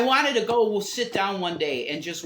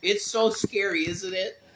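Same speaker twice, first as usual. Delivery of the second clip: mock scared; 4 semitones higher, 5 dB louder, slower than usual. Mock fear of reefer madness.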